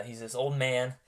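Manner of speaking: ordinary talk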